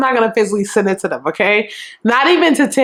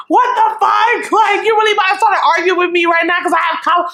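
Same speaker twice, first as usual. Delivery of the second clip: angry shout; 8 semitones higher than usual. Retold or imitated. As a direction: shout him down, indignant and fast. Boy yells back in argument.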